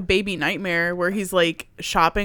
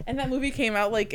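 normal talking voice

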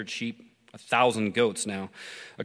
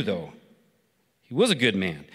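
ordinary delivery

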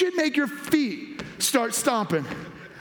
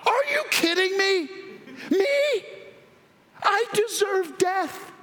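incredulous disbelief; 8 semitones higher than usual. Acted. Preacher acting out his own disbelief.

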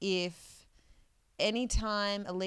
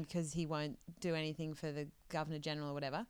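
ordinary talk

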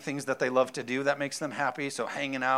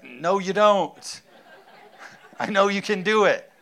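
laughing retort; 5 semitones higher, 8 dB louder than usual. Retold or imitated. Father answering daughter, laughing.

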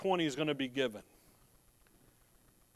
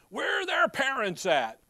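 loud, high-pitched mock outrage; indignant commenter; 8 semitones higher, 4 dB louder than usual. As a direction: imitate an indignant online commenter. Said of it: "I read one guy on the post".

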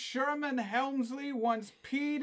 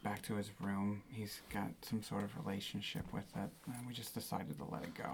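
a much lower, subdued voice throughout; deep-voiced staffer; 14 semitones lower, 11 dB quieter, faster than usual. Staff member interrupting the ladies about the bocce court, low and subdued. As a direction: keep it low and apologetic.

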